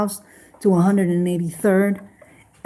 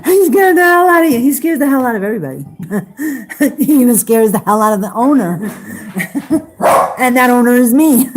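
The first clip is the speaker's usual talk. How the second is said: fast, loud, laughing through the words; 6 semitones higher, 6 dB louder, faster than usual. Real, not acted. Owner telling a viewer her dog scares everyone, laughing and fast.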